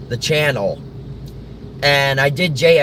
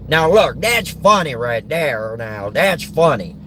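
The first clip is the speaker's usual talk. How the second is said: Larry the Cable Guy; 2 semitones higher than usual. Acted. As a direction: play a folksy stand-up, dragging out the words. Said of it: "But Larry the Cable Guy's like".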